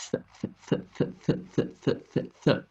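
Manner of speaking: "th" as a short voiceless puff, repeated; isolated th sound. Shown as a demonstration. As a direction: drill "th" alone, soft and airy, no full vowel. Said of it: "The hard bit is probably not the wi".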